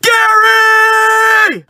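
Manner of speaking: very high, loud yell into the woods; high shout calling a lost buddy; screaming a name. Retold or imitated. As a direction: call out desperately to find your friend. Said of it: "I just start screaming".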